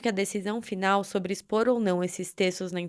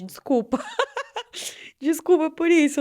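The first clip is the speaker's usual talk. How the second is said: higher, louder, awkward tearful laugh; laughing through tears; 8 semitones higher, 4 dB louder than usual. Real, not acted. Embarrassed laugh after crying.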